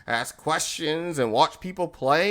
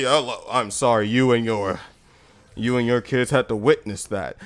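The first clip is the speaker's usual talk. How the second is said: low-pitched, smooth apology; deep mock-sincere sympathy; apologetic manager; 9 semitones lower than usual. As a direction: imitate a man lowering his voice to charm.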